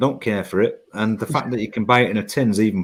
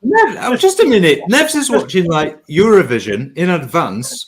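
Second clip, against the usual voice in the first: amused outburst; 5 semitones higher, 4 dB louder than usual. Real, not acted. Exclaims, high and loud.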